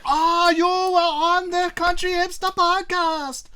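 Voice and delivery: a high, slow, hammed-up German accent; bad German accent. Imitating German fans greeting a podcast guest, bad German accent. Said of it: "that was not really a good German accent".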